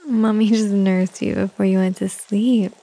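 usual speaking voice

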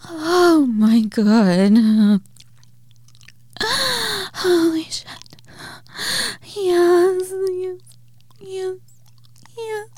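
high, loud ecstatic cries, drawn out; climax cries. Acted. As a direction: let it all out at the peak.

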